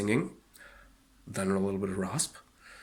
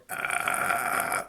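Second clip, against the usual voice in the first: false fold fry. Shown as a demonstration. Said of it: "that's the false fold fry".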